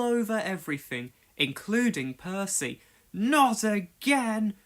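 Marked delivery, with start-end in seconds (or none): 3.1-4.5 slow, high weary groan; fed up with more dirt. A narrator's reading. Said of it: "Percy groaned".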